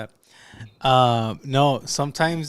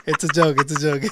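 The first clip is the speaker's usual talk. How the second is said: laughing; 2 semitones higher than usual. Real, not acted. Laughing at own joke.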